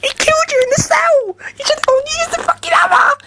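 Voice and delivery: high, loud yell, cracking with mock fury; mock outraged yell. Yelling at Sean that he should have used the hammer, loud and outraged. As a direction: scream the complaint as a furious fan.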